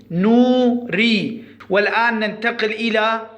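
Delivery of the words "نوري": نوري slower, broken into نو and ري; split syllables. Shown as a demonstration. Teacher breaking the word down for pupils, نوري syllable by syllable. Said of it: "نقول نوري".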